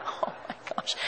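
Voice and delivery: breathless whispered gasp; a mother's silent horror at her toddler, whispered; inner thought. Retold or imitated. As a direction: whisper it to yourself in shock. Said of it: "In my head, I'm like".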